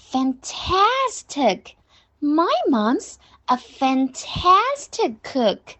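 "fantastic" stretched very slowly on TAS; slow model reading, hugely drawn-out "fantastic"; exaggerated emphasis. Shown as a demonstration. Teacher modelling praise of mom's cooking, exaggerated emphasis. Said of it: "要把fantastic读得很夸张".

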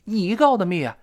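high-pitched betrayed friend protesting; acted accusation, pitch jumping up; agitated, stressed reproach. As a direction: plead indignantly with a buddy. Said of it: "一定要重读了".

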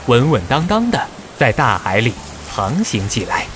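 plain reading voice

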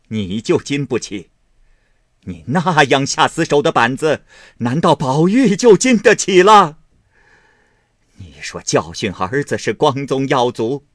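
loud, high indignant scolding; scornful anger. A narrator's reading. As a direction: throw his words back at him in fury. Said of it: "贾母听说，便啐了一口，说道".

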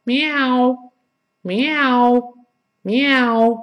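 high, drawn-out 喵 like a pet cat; cat's meow. Shown as a demonstration. Teacher likens the vowel to a house cat, high meowing. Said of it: "家里养小猫儿， 呃，叫唤时候".

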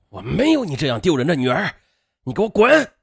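angry father building to a loud roar; furious disowning. Acted. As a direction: rebuke your daughter, then explode on the last words. Furious father disowning his daughter. (父亲怒斥，越说越凶)

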